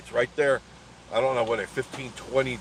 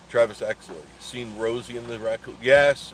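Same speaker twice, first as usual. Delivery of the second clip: normal talking voice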